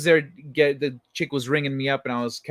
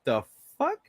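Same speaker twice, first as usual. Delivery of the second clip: startled; 10 semitones higher, 4 dB quieter than usual. Retold or imitated. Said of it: "I was like".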